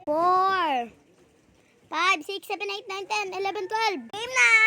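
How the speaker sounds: loud, quickening count; calling out the count. Real, not acted. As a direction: count fast, then shout the start. Counting then calling game start.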